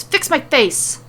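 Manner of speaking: understated angry yell; mild snarling yell. Acted. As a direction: bark it at her. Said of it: "turns to Liv and yells".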